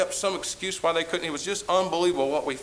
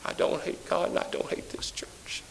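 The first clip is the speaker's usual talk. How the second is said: hushed breathy whisper, quiet but firm; the daughter's soft whispered refusal; whispered defiance; 6 dB quieter than usual. Retold or imitated. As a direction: whisper it, small and steady. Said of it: "she looked at him and said".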